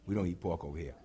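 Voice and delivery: low quiet unbothered dismissal; calm dismissive reply. Retold or imitated. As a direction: brush them off quietly. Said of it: "Jesus said".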